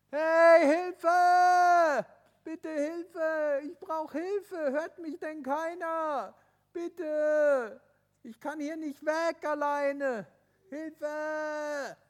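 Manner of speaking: loud, high, drawn-out cries for help; desperate calls for help. Acted. Tobi alone on the mountain, calling out loudly. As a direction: yell as if nobody can hear you. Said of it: "Hat der Tobi gerufen".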